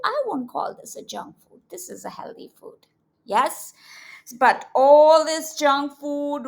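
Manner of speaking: ordinary delivery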